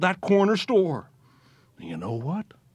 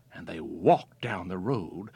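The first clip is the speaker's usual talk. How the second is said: plain reading voice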